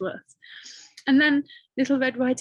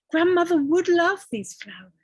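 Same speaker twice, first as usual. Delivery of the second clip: put-on girl's voice; 8 semitones higher, 5 dB louder than usual. Girl's thoughts voiced in story.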